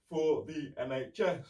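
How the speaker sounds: yawning while talking; words through a yawn. Real, not acted. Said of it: "I'm yawning so much".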